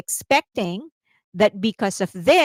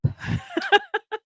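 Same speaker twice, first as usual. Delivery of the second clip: a sudden high-pitched giggle; high laugh; 4 dB quieter than usual. Real, not acted. Vlogger laughing at hoping a Chinese member helps, high giggle.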